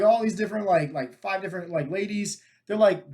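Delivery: usual speaking voice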